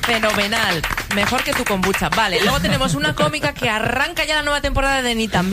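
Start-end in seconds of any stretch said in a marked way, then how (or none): none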